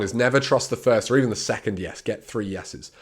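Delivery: ordinary delivery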